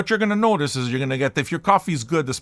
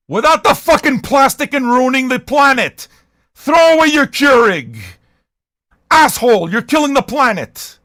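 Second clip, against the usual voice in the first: loud, high-pitched outburst at the viewer; yelled comic tirade; angry rant; 8 semitones higher, 8 dB louder than usual. Acted. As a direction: explode into a shouted scolding. Ranting at viewers who use Keurig.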